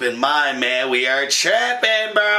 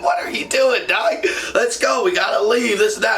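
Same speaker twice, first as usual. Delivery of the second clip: higher, pushier buddy voice, lightly voiced; impatient buddy; 9 semitones higher than usual. Buddy hurrying his tripping friend out the door, pushy. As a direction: nudge him along like a friend who wants to go. Buddy urging him to leave.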